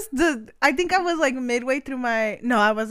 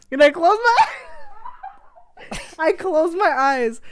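slow, laughing retelling; laughing while telling; 5 semitones higher, much slower than usual. Real, not acted. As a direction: giggle through each word. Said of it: "like if I was falling asleep".

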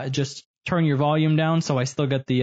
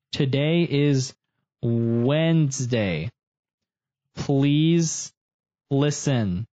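slow dictation; much slower than usual. Shown as a demonstration. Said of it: "I am going to say".

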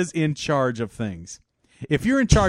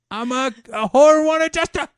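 mock adjuster; 8 semitones higher, 6 dB louder than usual. Proud adjuster self-intro.

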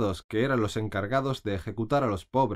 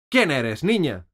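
imperious question; 5 semitones higher, 6 dB louder, slower than usual. A narrator's reading. Queen questions Alice.

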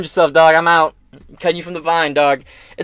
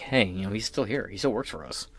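sly hushed aside; 7 semitones lower, 12 dB quieter than usual. Retold or imitated. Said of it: "was like".

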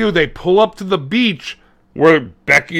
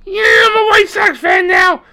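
put-on high, strained, whining kid; high mock-crying whine; whiny kid voice; 14 semitones higher, 4 dB louder than usual. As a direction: squeak it out like a teary kid. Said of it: "And he's like".

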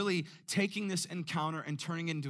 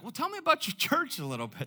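higher, faster folksy farmer; older farmer voice; 10 semitones higher, faster than usual. Retold or imitated. Older farmer asking the pastor about church, in a high, quick voice. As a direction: chirp it like a friendly old farmer. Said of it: "he's like".

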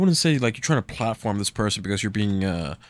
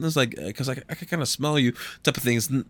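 ordinary delivery

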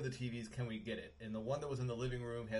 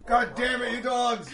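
very loud, high-pitched shout at the dogs; real angry yell; 12 semitones higher, 15 dB louder, slower than usual. Real, not acted. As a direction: scold barking dogs at full volume. Real yelling at barking dogs.

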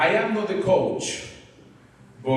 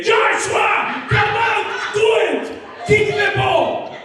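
yelling at the game; 12 semitones higher than usual. Retold or imitated. Dad retelling his yells from across the field. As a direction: holler at your son to kick it. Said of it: "I start yelling there".

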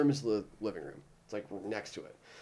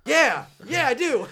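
much higher and louder eager yes; giddy high yes; 13 semitones higher, 13 dB louder, slower than usual. Retold or imitated. Roommate accepting backstage invite, giddy squeal. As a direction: squeal it like a thrilled fan.

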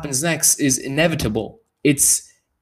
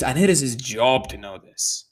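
ordinary talk, nothing marked

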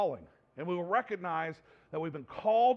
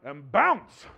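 punchy loud bounce; 3 semitones lower, 11 dB louder than usual. Acted. Punchline of scripture paraphrase.

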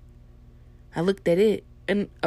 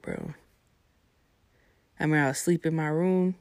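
normal talking voice

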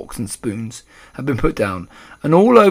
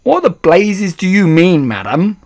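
loud, high outburst; indignant shout; 7 semitones higher, 10 dB louder than usual. A narrator's reading. Father outraged at the grandmother's claim, shouting. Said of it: "shouted Mister Jenkins".

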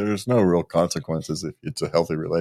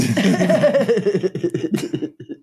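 long, high burst of laughter; real high-pitched laughing fit; cracking up; 12 semitones higher, 3 dB louder than usual. Real, not acted. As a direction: crack up at your own absurd excuse. Cracks up at safari excuse.

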